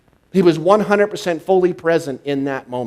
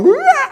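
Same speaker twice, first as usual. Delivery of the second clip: put-on piercing high little-girl scream, much louder; imitating Boo's screech; 9 dB louder than usual. Retelling Boo freaking out at the monsters, with a high shrieking scream. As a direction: let out a child's shrieking "Rah!". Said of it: "she has this screech".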